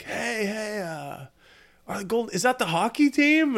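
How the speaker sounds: high, excited passerby calling out; curious stranger voice. Retold or imitated. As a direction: play a curious fan at a red light. Strangers at red light asking.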